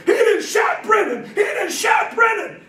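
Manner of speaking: high, loud panicked shouting, slowing on the repeat; panicked cry. Retold or imitated. Brothers in the back seat screaming that Brennan was shot, panicked high cry.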